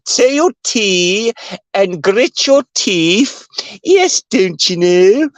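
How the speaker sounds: slow, high, plummy mock posh English; drawn-out posh English, "Yes, don't you know?"; mock posh elocution. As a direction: lampoon an elocution teacher in posh English, slowly. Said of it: "elocution lessons".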